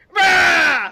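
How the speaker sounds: high, loud hammed-up roar; mock roar. Retelling the fight scene to friends, high mock roar. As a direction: bellow it like a crazed strangler. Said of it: "He's like".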